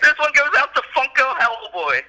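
very high-pitched concert-style tribute; hammed-up rallying cry; mock dedication shout. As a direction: holler it out half laughing. Continuing the shouted dedication bit.

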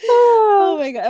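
high, loud, drawn-out squeal; a delighted shriek of disbelief; squealing oh my God. Real, not acted. Host squeals at her co-host's dramatic sigh.

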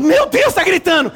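high and loud, alarmed; alarmed exclaim. Retold or imitated. Father alarmed to his wife as the girl screams, high alarmed exclaim. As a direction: blurt it in panic. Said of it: "Eu falei".

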